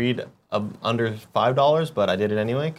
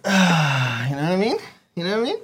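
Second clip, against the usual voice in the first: Kevin; 5 semitones higher than usual. Acted. Second go at imitating a colleague, high and rising. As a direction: repeat the question, climbing higher. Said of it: "Or like".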